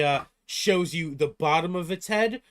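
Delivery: ordinary delivery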